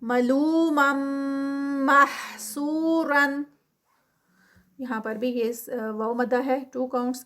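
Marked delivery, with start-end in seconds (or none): 0.0-3.4 slow مَحْسُورًا with a stretched و and a heavy ر; Quranic recitation. Shown as a demonstration. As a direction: chant it slowly as a tajweed drill. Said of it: "غنہ ادغام ہے".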